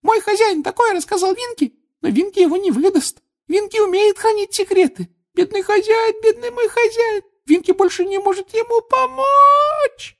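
loud, very high elf lament; sobbing Winky. A narrator's reading. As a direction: build from loyal protest to a tearful wail. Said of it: "Снова зашлась в рыданиях Винки".

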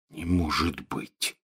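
slow, hushed horror; horrified babble. A narrator's reading. Ron stares at his parchment in horror, faint stunned voice. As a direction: murmur it low and slowly, aghast. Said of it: "пролепетал Рон с ужасом".

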